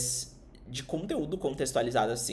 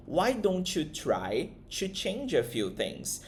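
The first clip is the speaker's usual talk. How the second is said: slow model sentence; 3 semitones higher, much slower than usual. Shown as a demonstration. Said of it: "Então".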